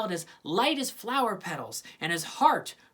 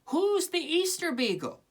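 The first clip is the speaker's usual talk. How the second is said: curious Sally voice; 10 semitones higher than usual. Acted. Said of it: "she asked".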